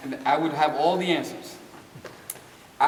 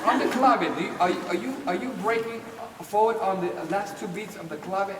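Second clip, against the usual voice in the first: quizzing dancer; 6 semitones higher than usual. Retold or imitated. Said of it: "asking me all these theoretical questions".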